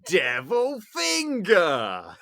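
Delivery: slow, loud, high Devil Finger; yelled catchphrase. Retold or imitated. Kids at school poking each other, slow loud yell.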